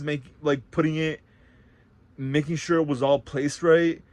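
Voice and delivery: ordinary delivery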